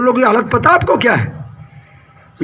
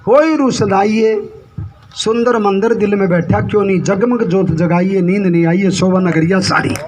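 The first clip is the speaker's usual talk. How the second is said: hymn verse recital. Acted. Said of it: "एक शब्द में कहा है".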